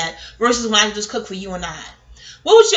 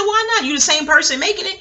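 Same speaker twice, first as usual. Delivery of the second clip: higher-pitched mock challenge; retold challenge; 8 semitones higher, 3 dB louder than usual. Friend challenging the cook over the rice, challenging. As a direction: question it like a pushy friend.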